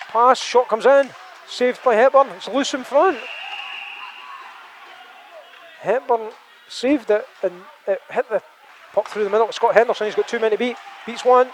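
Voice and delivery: rising excited commentary; play-by-play. Acted. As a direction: call the rush live.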